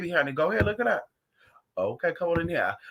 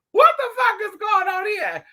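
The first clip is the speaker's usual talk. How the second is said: much higher, louder shocked yell; mock outraged shout; 13 semitones higher, 5 dB louder than usual. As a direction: repeat it as a disbelieving scream. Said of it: "I said".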